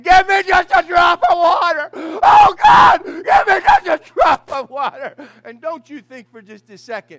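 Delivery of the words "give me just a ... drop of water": desperate high, loud shout; rich man crying out. Acted. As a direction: cry out for water from torment. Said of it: "rich man crying out and saying".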